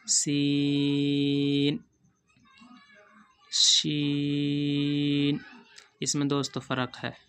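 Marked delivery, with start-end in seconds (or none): 0.1-5.4 सीन and शीन stretched long, s then sh; drawn-out letter names. Shown as a demonstration. Teacher stretching सीन and शीन for students. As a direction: hold each letter name slowly. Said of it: "ये छोटा सीन है, ये बड़ा शीन है".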